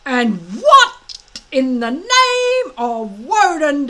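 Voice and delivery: slow, loud, high indignant demand. Acted. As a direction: demand an answer, outraged. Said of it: "demanded Gobber the Belch".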